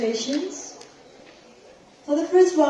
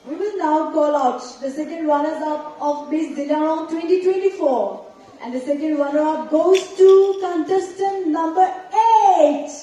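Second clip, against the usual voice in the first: booming pumped announcer; 4 semitones higher than usual. Acted. Announces second runner-up.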